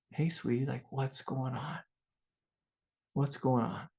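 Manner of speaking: put-on soft, reassuring question to a scared child; gentle soothing father. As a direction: softly ask the crying girl what's wrong. Father soothing crying daughter.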